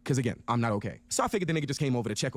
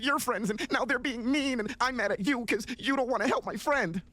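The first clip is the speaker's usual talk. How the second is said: high, slow whiny-kid imitation; hammed-up schoolgirl complaint, pitched way up; mock eleven-year-old girl; 8 semitones higher than usual. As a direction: imitate a petty little girl, drawn out and high. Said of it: "acting like an eleven-year-old girl".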